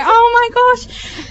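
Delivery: high, slow breathless "oh my gosh"; a rising squeal of shock; excited gasp. Retold or imitated. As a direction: gasp it out, thrilled and disbelieving. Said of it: "I'm like".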